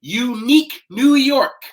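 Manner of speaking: over-articulate "Unique New York", every sound crisp; exaggerated tongue twister. Shown as a demonstration. Coach demonstrating the tongue twister for the class to repeat, exaggerated. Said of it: "Exaggerate the sounds and be louder".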